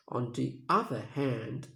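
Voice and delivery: "other" stressed, said slowly; slow demo of the stressed "other". Shown as a demonstration. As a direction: lean on "other" and take it slow. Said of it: "The stress word is other".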